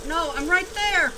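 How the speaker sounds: excited straining. Real, not acted. Tense near-miss in game.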